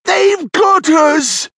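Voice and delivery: slow, loud, very high cry; high-pitched, drawn-out terror; panicked wail. A narrator's reading. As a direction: wail it like a trapped thief. Young thief caught in a net.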